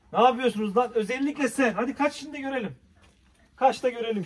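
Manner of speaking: high, loud teasing at the animals; teasing call. Real, not acted. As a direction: tease them playfully. (hayvanlara yüksek sesle takılma)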